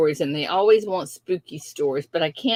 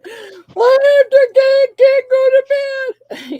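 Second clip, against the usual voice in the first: high, slow, scared whimper; scared child whine; 10 dB louder, much slower than usual. Retold or imitated. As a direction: whimper it like a frightened little kid. Imitating scared grandkids at bedtime.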